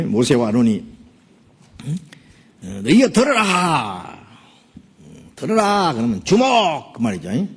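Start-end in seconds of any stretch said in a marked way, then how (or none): 2.8-5.9 slow, higher, commanding rebuke; angry Moses. Acted. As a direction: bark the order at the crowd. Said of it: "모세 화가 나가지고요".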